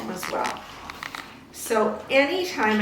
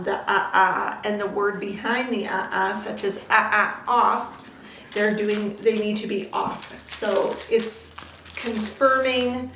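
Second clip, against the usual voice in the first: usual speaking voice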